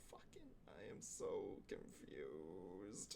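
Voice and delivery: slow whispered confusion; confused whisper. Real, not acted. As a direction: mutter it under your breath. Muttered confusion at night mode.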